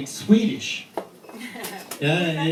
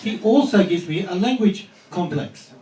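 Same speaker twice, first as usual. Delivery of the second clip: usual speaking voice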